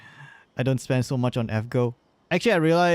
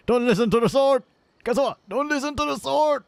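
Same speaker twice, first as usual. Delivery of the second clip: higher, urgent plea to the character; pleading; 10 semitones higher than usual. Acted. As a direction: beg him not to listen. Begging a game character.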